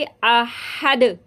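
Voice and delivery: अहद with the final द bounced back (qalqala); echoing dal. Shown as a demonstration. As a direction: let the द of अहद rebound. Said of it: "दाल की आवाज़ यहाँ पे पलट कर आएगी". (अहद में दाल पलटकर)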